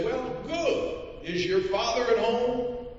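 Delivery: high, polite official voice; fussy census taker. Acted. Census taker asking a mountain boy, in a high polite voice.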